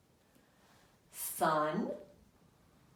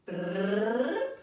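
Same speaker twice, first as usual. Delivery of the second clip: drumroll; 7 dB louder than usual. Shown as a demonstration. Drumroll while children follow finger.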